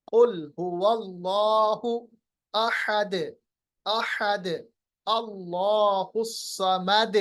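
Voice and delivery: slow recitation, अहद and समद echoing on द; qalqalah recitation. Shown as a demonstration. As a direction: recite measured, bounce each final द. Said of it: "अहदुन को बनाएंगे अहद". (धीमी तिलावत, दाल का क़लक़ला)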